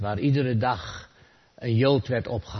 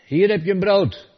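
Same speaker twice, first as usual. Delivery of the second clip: louder, higher, tossed-off call; 8 semitones higher, 9 dB louder than usual. Retold or imitated. Atheist throws bread through the window, calling out loudly. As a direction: fling the words in with the bread.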